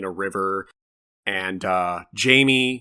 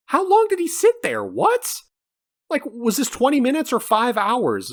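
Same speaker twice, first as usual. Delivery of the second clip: very high-pitched, baffled outburst; incredulous; 11 semitones higher than usual. Acted. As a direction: question it in squeaky disbelief. Acts out baffled viewer reaction.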